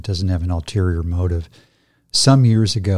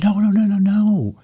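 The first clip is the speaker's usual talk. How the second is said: insistent teacher; 11 semitones higher than usual. Retold or imitated. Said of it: "And he said".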